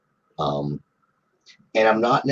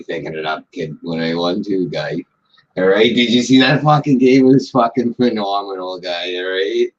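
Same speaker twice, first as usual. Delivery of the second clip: Boston banter, thickened on purpose; thick Boston accent. Shown as a demonstration. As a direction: show them how Boston really sounds. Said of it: "That's how you do it".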